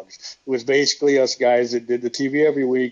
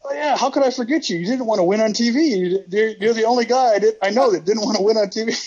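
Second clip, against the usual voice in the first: much higher, cheery greeting voice; Terry Taylor greeting; 8 semitones higher than usual. Retold or imitated. Terry greeting Bob after twenty years, much higher delighted voice. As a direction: squeal out a delighted hello. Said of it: "he said".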